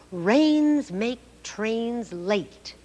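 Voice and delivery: very slow, deliberate tongue twister. Shown as a demonstration. Teacher reading a tongue twister slowly for children. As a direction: say each word of the twister slowly. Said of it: "Let's read it slowly".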